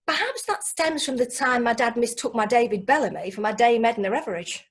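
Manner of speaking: ordinary talk, nothing marked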